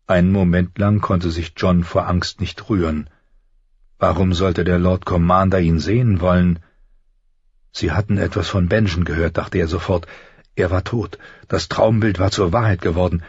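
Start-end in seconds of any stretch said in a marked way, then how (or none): none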